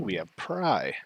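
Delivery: usual speaking voice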